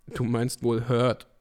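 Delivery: ordinary talk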